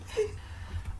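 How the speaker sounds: quick unvoiced giggle; breathy giggle. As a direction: giggle at the teasing. Laughs at coworkers' teasing.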